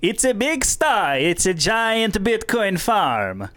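put-on slow, high Borat accent; hammed-up Kazakh Borat impression. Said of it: "Can I just do Borat?".

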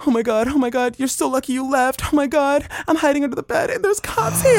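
high-pitched frantic phone voice; hammed-up squeal of alarm; mock panic. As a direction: imitate a friend hiding from the cops. Retells friend panicking under bed.

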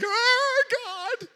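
hammed-up, high-pitched wail; mock crying. Preacher imitating himself crying to God, mock wailing. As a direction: cry out in exaggerated, pretend distress. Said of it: "I'd be crying all the time".

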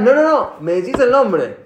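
high, loud cutting-off refusal; insistent demand. Acted. Therapist pressing a patient for a name, insistent.

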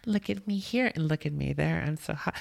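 high, vain imitation of models; put-on high show-off voice; mock Instagram model. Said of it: "follow a couple of models".

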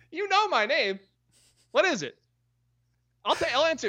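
squeaky teasing; high silly voice. Pretend.

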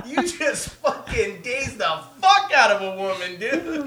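loud, talking through laughter; laughing hard. Retold or imitated. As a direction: get the words out while cracking up. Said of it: "starts laughing till he's crying".

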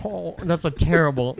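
ordinary delivery

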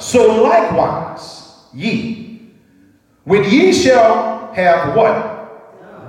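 slow, drawn-out reading voice; slow scripture reading. Acted. Pastor reading Luke aloud, slow and drawn out. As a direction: lay out each word slowly for the church.

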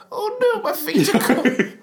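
very high squeaky character voice; Monroe. Acted. Little character finds his feet covered, high mock dismay. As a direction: wail it in a comically high, drawn-out voice. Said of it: "remember Monroe, our little character".

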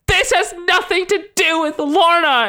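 very high, drawn-out protest; hammed-up wail, overly defensive; mock outraged denial. Acts Iceman's overwrought denial.

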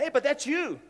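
high, loud "that's you"; spotting the pastor's picture; surprised recognition. Retold or imitated.

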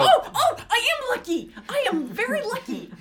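high, loud gleeful squeal; excited. Real, not acted. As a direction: crow about your luck. Gloating over a lucky roll.

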